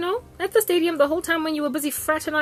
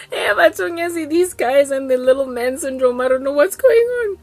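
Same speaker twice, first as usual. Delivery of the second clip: laughter-filled, raised voice; laughing; 5 dB louder than usual. Real, not acted. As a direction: speak while still cracking up. Still recovering from laughing fit.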